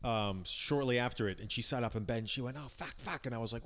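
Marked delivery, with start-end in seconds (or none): 2.6-3.3 hushed Aussie accent impression of wife; wife's Australian accent. Comic retelling of wife waking up, in a quiet mock Australian accent. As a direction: softly mimic an Aussie wife cursing. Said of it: "like how you do your wife's accent".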